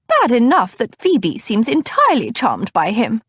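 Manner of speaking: put-on high, indignant older lady voice; huffy older cousin. A narrator's reading. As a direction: huff it out disapprovingly. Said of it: "Cousin Batilda huffed".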